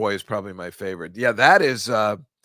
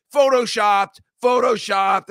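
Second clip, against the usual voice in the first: higher, louder taunting chant; jeering crowd; 9 semitones higher, 8 dB louder, slower than usual. Retold or imitated. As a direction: jeer it like online trolls. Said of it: "They're like".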